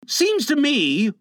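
put-on high, slow boyish grumble; growled grumble. A narrator's reading. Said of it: "growled John".

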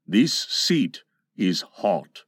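low, slow pirate captain; wary Captain Hook. A narrator's reading. Hook feels heat under him, low slow puzzlement. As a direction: let it dawn slowly, deep-voiced. Hook notices the hot seat.

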